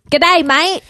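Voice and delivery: loud, high hammed-up Aussie greeting. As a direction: ham up an Australian greeting. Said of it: "stereotypical Australian accent, correct?".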